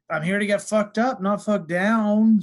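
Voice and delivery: boisterous party-guy declaration; Ryan Malone. Retold or imitated. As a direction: belt it out, dragging out the last words. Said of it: "He's always like".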